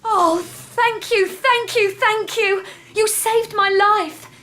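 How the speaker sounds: high, loud, gushing thanks; relieved gratitude. Acted. Freed captive thanking her rescuer, gushing relief. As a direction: pour out thanks in a high, overjoyed rush of relief.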